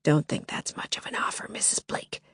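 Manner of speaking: soft, low, hissed threat; masked intruder taunting Lily, whispered and menacing. Acted. Sinister whispered taunt.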